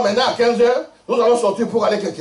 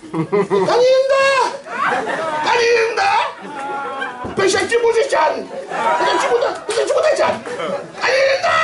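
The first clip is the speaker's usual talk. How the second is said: shouting a name. Retold or imitated. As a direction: yell the name as if to someone far off. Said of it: "Tellement il criait".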